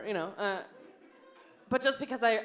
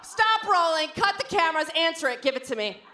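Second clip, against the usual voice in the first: loud demand; 6 semitones higher, 4 dB louder than usual. Retold or imitated. Phone grab.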